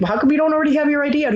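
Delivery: quick, high mock reproach; retold scolding. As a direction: fire off the reproachful question high and fast. Clerk scolds returning mayor.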